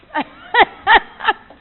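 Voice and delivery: high, loud burst of laughing; real belly laugh; loud laughter. Real, not acted. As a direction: crack up at your own punchline. Laughing at her own punchline.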